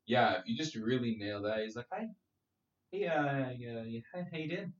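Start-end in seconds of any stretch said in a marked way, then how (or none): 1.9-4.7 held-back, hesitant Kiwi voice, much softer; soft, halting Kiwi impression; Taika Waititi. Showing how to nail a Kiwi, held back and soft. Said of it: "held back, kinda, like, high-pitched voice".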